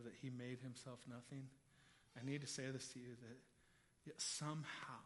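low, hushed, weighty sermon voice; solemn preaching. Real, not acted. Preacher telling the church that Christ made himself nothing, hushed and solemn. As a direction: bring it down soft and grave, with long pauses.

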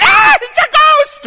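very high, loud mock shriek of terror; little-girl scream. Preacher mimics disciples panicking at a ghost, little-girl scream. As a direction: scream it like frightened little girls, drawn out. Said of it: "They scream like little girls".

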